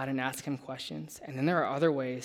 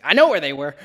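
louder, sharp interrupting retort; exasperated outburst; 12 dB louder than usual. Retold or imitated. Retelling cutting off the cop, a loud exasperated blurt. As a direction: blurt it over him, fed up.

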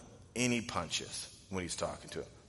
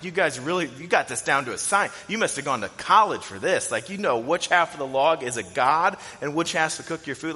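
louder sarcastic jab; mocking; 5 semitones higher, 7 dB louder than usual. Isaiah to the idol makers, sarcastic mocking. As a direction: tease them like a smug mocker. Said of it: "mocking them".

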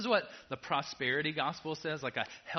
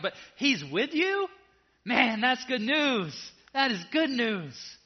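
full-force preaching; 8 semitones higher, 6 dB louder than usual. Acted. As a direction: proclaim it like a revival preacher.

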